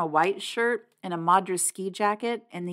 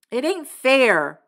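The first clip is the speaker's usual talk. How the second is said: higher, louder, slow passionate cry; 7 semitones higher, 7 dB louder, slower than usual. Acted. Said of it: "I cried passionately".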